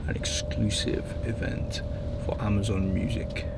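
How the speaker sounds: soft whisper. Real, not acted. Hushed aside.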